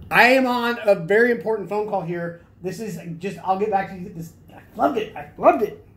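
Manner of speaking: loud, high, hammy phone-call act; forced acting. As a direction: overplay a busy man on the phone. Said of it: "It felt a little forced".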